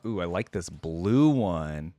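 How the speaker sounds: ordinary talk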